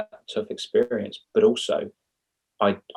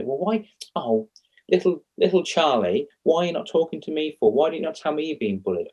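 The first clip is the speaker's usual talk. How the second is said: much higher, hammed-up worried parent; mock fussing parent; 7 semitones higher than usual. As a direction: wail it like an over-fussy parent. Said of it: "for them to say".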